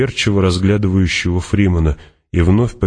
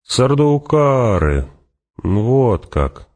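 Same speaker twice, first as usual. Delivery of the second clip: drawn-out, higher, swooping relish; grim merriment; 7 semitones higher, much slower than usual. A narrator's reading. Fremen learns who the enemy was, grimly amused. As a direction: stretch the name with a touch of menacing glee. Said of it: "зазвучало недоброе веселье".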